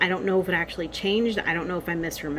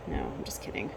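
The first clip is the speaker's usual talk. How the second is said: very soft, low whisper; hushed take-back; whispered aside; 13 dB quieter than usual. Real, not acted. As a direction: drop to a whisper to retract the joke. Takes back a dark joke.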